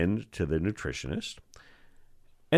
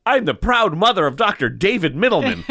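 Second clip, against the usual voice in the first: higher, louder boastful announcement; proud mother; 10 semitones higher, 6 dB louder than usual. Retold or imitated. Mother boasting to the professor, proud loud announcement. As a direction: show off the son to the whole hall.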